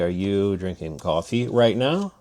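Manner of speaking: usual speaking voice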